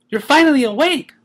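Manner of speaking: high, bright and happy; cheerful greeting; joyful. Acted. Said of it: "Pinkie joyfully said".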